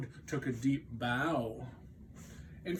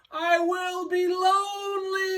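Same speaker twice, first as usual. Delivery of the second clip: desperate shout; 8 dB louder than usual. Acted. Toad desperate for Frog.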